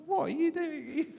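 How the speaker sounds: high, loud, shocked cry of disbelief; incredulous colleagues. Retold or imitated. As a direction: gasp it out like baffled coworkers watching. Colleagues watching her pray.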